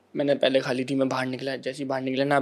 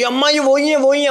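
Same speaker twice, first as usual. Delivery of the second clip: much higher, louder, breathless fan excitement; imitated excited girl; 11 semitones higher, 4 dB louder than usual. Girl telling her mother about the viral star, mock excited squeal. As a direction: squeal it to your mother, "it's HIM".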